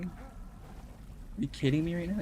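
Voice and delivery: slow, high, drawn-out whine; exasperated whine. Real, not acted. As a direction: plead with the game in disbelief. Stuck in game, frustrated.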